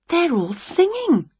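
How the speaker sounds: high voice of a lightly played witch; witch's discovery. A narrator's reading. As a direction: report it high, as a peeping witch. Winnie peeping through window.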